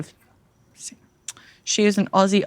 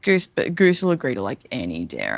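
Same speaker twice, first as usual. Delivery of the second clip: ordinary talk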